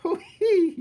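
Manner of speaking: high, loud cackling cook; laughing cook. Acted. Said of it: "she said again".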